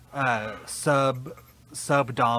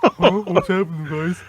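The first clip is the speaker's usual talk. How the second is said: loud, higher mock-clueless question; feigned innocence; 5 semitones higher, 8 dB louder than usual. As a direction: play dumb as if nothing happened. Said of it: "He's gonna pretend nothing happened".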